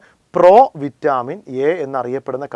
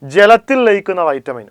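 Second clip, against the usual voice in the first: emphatic teaching; 6 semitones higher, 7 dB louder than usual. Shown as a demonstration. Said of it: "രണ്ടായി തിരിക്കാം".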